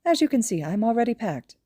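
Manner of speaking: high, brisk, smug voice; high principal voice. Acted. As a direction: talk fast and pleased with yourself. Nezu's voice.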